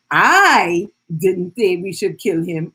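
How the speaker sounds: "I" drawn out and punched, the rest falling away; slow demo with heavy emphasis on "I"; stress on I. Shown as a demonstration. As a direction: lean long and hard on the word "I". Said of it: "emphasize a different word".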